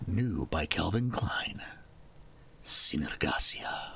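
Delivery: low, slow, whispery cologne commercial; perfume ad voice. Pretend. Streamer spoofing a cologne commercial, perfume ad voice. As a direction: sell it in a deep, hushed murmur. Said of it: "It sounds like a perfume or men's cologne".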